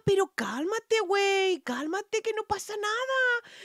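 very high, mocking calm-down voice; hammed-up plea to calm down; mock soothing boyfriend. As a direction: boyfriend telling her to calm down.

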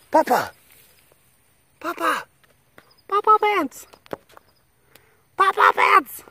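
very high, loud call; calling the cat. Real, not acted.

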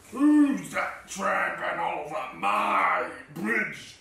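deep, drawn-out troll growl; roaring troll. A narrator's reading. Angry troll challenges the third goat, roaring low. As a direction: growl slowly, getting very angry. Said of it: "roared the troll".

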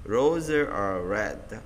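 very slow, deliberate repetition; slow demo repeat. Shown as a demonstration. Teacher repeating the drill for students, very slow. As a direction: say each word slowly for learners. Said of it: "Ví dụ như câu các bạn sẽ thấy này".

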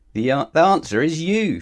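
high, loud reveal; triumphant. A narrator's reading. Mister Dizzy answering his own riddle, loud and triumphant. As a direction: announce it with relish.

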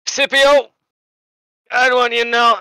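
woozy from anesthesia, tender and high; drugged, sappy earnest voice; anesthetized patient. Acted. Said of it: "he goes".

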